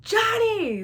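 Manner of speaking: high, loud imitated call; mad mom. Mom summoning dad, angry imitation. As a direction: holler the name like a furious mother. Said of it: "she was so mad".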